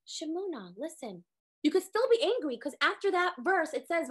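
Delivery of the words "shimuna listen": much softer, tender call to listen; almost hushed, pulling them close. Retold or imitated. Hashem calling Miriam softly despite his anger, hushed. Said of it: "he spoke to them softly".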